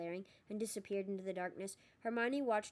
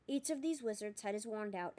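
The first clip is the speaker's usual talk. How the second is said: ordinary delivery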